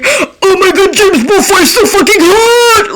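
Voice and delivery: high squealing fangirl shout; mock fan squealing over her crush; gushing fan. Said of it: "like, saying".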